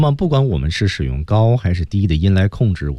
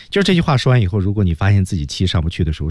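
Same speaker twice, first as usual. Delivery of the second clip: ordinary talk, nothing marked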